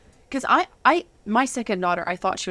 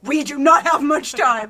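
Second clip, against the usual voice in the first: slow, louder, urgent warning; 5 semitones higher, 6 dB louder, slower than usual. Retold or imitated. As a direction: tell him each word slowly, urgently. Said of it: "Like, I was freaked out".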